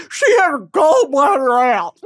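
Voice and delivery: a loud mocking imitation of gossip. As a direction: mimic the gossip loudly.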